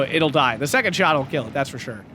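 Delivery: ordinary talk, nothing marked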